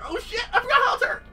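high, loud panicked yelp. Retold or imitated. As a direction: squeal it in mock panic. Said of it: "I'm like".

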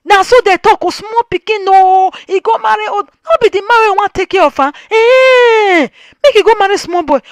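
high, sneering voice of gossips; mocking gossip. Vlogger mimicking gossipers, high and sneering. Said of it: "mocking what gossips say".

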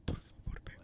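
breathy begging murmur, barely voiced; ASMR whisper. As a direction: coax them in with an ASMR whisper. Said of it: "En plan A S M R".

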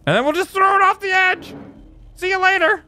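high-pitched call as it flies; gleeful send-off. Real, not acted. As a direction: sing out a high, playful goodbye. Throwing explosive off the edge.

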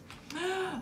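high, soft storybook gasp; theatrical little intake of breath. A narrator's reading. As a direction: draw in breath in suspense. Narrator gasps at the story.

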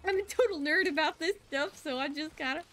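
real geeky excitement; excited. Real, not acted. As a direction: gush like a total nerd. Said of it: "I get so excited".

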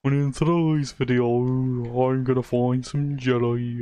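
put-on slow, drawled weird accent. Mocking a friend's video intro to his audience, weird accent. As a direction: drag out the vowels in a silly accent. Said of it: "I don't know why I gave you a weird accent".

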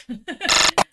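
sudden loud burst of laughter. Real, not acted. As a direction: laugh out loud, caught off guard.

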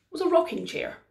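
usual speaking voice

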